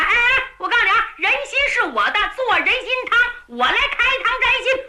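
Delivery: high, fast, gleeful menace; clamor for your share of the prisoner; rapid-fire high-pitched bullying; shouted threat. A narrator's reading. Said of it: "汤琼金喊".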